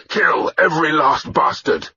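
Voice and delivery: war leader's high, forceful yell; shouted. Acted. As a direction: shout the order to the whole crew. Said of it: "I shouted".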